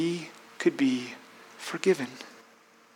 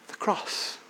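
soft unvoiced whisper; hushed reverent answer; 8 dB quieter than usual. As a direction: breathe the two words reverently.

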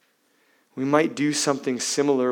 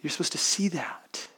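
whisper; 3 semitones higher, 5 dB quieter than usual. Real, not acted. Hushed aside in sermon.

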